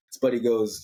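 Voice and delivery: ordinary delivery, nothing marked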